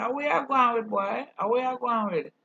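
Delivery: loud, high mock call-out; acted loud challenge. American who learned patois challenging a seller, acted shout. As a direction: holler it like stopping a cheat.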